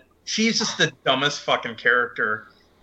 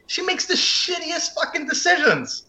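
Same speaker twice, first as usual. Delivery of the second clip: pitched way up in disbelief; high exasperated rant; 10 semitones higher than usual. Real, not acted. Ranting to the podcast hosts, high exasperated voice.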